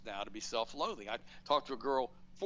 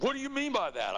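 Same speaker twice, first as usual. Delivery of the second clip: mock girl's retort, much higher and louder; mocking the angry girl; 12 semitones higher, 5 dB louder than usual. A college girl on the tram firing back, mocked high. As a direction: lightly parody her indignant snap. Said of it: "She said".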